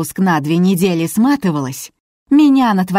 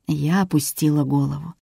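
plain reading voice

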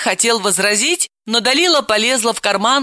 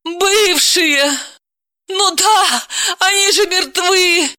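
much higher, louder wail; 13 semitones higher, 3 dB louder, slower than usual. Acted. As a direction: cry out in disbelief. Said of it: "взвыла Далила".

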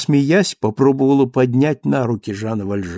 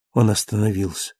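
usual speaking voice

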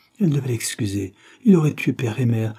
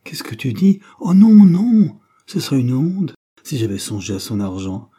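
loud, much higher cry of denial; fervent protest; 3 semitones higher, 8 dB louder than usual. A narrator's reading. Wife protesting to her husband, loud and high. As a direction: reject the very idea with all your being. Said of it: "dans une protestation de tout son être".

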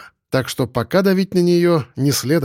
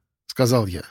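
ordinary delivery, nothing marked

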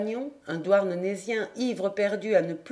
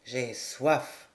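put-on low, thick-tongued drunk; drunken mumble; 8 semitones lower, 4 dB quieter than usual. A narrator's reading. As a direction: chew the words sullenly, deep in drink. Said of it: "Le misérable mâchonna".

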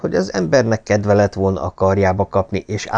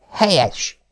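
raised, lightly voiced stern father; stern old lord; 8 semitones higher than usual. A narrator's reading. Lord Travers lecturing his thieving son, higher stern voice. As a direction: lay down the law to the heir.

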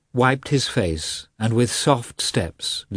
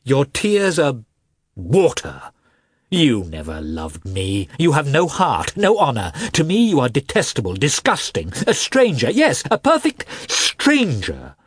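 rising higher and louder with every insult; wife hurling insults at her husband, louder and louder; growing excitement; 5 semitones higher, 3 dB louder than usual. A narrator's reading. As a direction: build from cold scorn to a furious peak. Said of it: "getting more and more excited".

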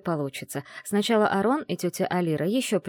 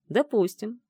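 smirking; 4 semitones higher, slower than usual. Acted. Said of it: "с усмешкой кивнул ведьмоборец".

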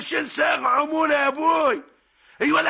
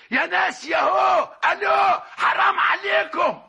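desperate shout; 5 semitones higher, slower than usual. Acted. Desperate cry on the phone.

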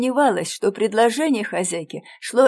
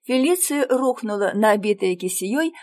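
ordinary delivery

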